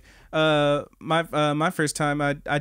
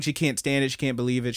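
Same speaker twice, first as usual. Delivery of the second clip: ordinary talk, nothing marked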